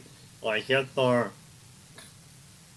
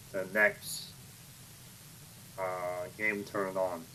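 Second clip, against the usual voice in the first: normal talking voice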